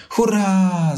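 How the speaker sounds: high, joyful cheer. A narrator's reading. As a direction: shout hooray with delight. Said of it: "zawołał Lisek". (radosny, wysoki okrzyk hurra)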